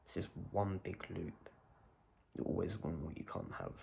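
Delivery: ordinary talk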